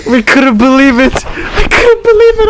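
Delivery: high, breaking laugh through the words; can barely get it out for laughing; laughing in disbelief. Real, not acted. Telling a friend the story, laughing in disbelief. Recalling shocking discovery, laughing.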